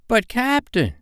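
very high, whining objection; high-voiced baffled crewman; bewildered protest. A narrator's reading. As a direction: protest to the captain in disbelief. Pirate protests the strange order.

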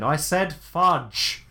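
very slow, deliberate cover-up; slow, careful substitute for a curse; styling it out. Acted. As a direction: drag it out to cover a near swear. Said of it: "try to style it out".

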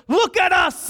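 high, forceful call in one burst; urgent shouted command. Acted. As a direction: shout it as one sudden demand. Peter's command, louder retake.

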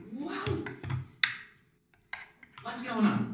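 high, startled cry; Leon waking startled. Acted. Game master as companion waking up amid the bugs, startled cry. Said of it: "Leon sits up and he's like".

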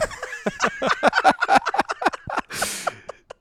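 high-pitched breathy burst of laughter. Real, not acted. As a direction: laugh out in delight. Reacting to the news.

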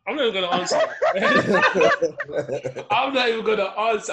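slow, drawn-out words through laughter; laughing refusal. Real, not acted. Refusing to answer friends about gagging, laughing. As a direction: refuse while trying not to crack up.

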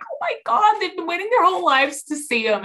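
high-pitched retold amazement; thrilled gushing. Fan moved by strangers' lucky sighting, gushing high. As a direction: gush in thrilled disbelief.

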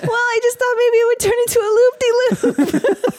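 yelling over the wind. Pretend. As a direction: shout it over rushing wind. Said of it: "Why are you two yelling?".

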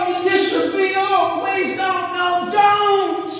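high, wailing plea for mercy; desperate begging. Acted. Debtor begging the lender to spare him from prison, high wailing plea. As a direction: beg him like your life depends on it.

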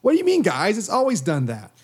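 mock indignant. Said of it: "Every time I talk, it says this now".

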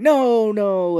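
high, loud protesting cry; mock anguished no. Host mimics Steve Martin denying, loud high cry. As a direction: wail it in hammed-up panic. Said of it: "he's like".

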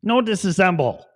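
high robot voice pleading; squeaky pleading robot; Johnny Five. Retold or imitated. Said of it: "It's like Johnny Five".

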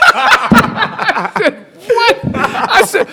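loud laugh then high What; big shocked laugh. Real, not acted. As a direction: burst out laughing in disbelief.

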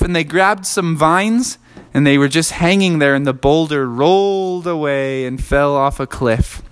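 usual speaking voice

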